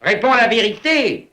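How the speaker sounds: high, insistent command to answer; stern demand. Acted. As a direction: press him sharply for an answer, pitched well up. Father demanding a frightened son's answer.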